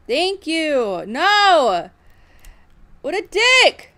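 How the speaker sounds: loud, high outburst of disbelief; shouting as a teammate snatches the gloves again; furious shout. Real, not acted. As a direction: explode in outrage.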